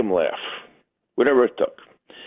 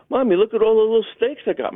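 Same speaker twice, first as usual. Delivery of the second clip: put-on high little kid voice; excited small boy showing off; little boy calling to his mom; child voice; 7 semitones higher than usual. Child Richie voiced in story.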